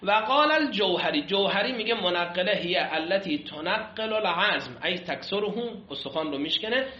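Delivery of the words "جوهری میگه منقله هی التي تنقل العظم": usual speaking voice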